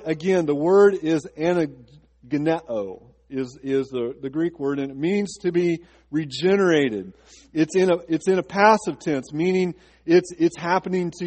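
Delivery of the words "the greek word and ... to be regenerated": normal talking voice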